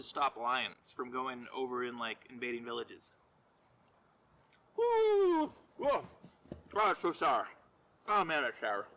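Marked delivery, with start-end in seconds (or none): 4.8-6.0 high yelp at the sour bite; sour shock whoop. Real, not acted. First bite of sour fruit. 6.7-8.9 puckering from a sour bite; eating sour candy. Real, not acted. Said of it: "That's a really sour fruit".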